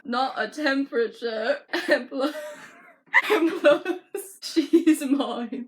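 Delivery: put-on loud British accent broken by laughing. [name] claiming the girl from [name], laughing British accent. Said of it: "keep up the British accent".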